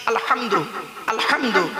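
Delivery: আলহামদু with the h breathed from the throat; throat h in alhamdu. Shown as a demonstration. Preacher teaching the crowd the throat h. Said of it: "এই গলার ভেতর থেকে হেটা উচ্চারণ করবেন".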